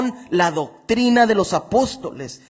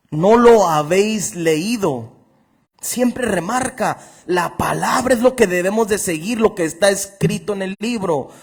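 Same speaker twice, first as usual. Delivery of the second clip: fervent preaching; 2 semitones higher, 4 dB louder than usual. Acted. Pastor preaching to his congregation, fervent and raised. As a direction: preach it out with fire. Preacher reading scripture with fervor.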